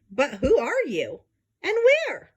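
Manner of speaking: rising, searching call of a kindly elephant; Horton calling. Acted. Horton searches for the tiny caller, rising call. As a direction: call out to the unseen voice. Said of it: "said Horton".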